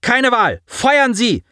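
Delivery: high, forceful command without full volume; screamed order. A narrator's reading. Commander to tactics, screaming to open fire. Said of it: "schrie sie die Taktik an".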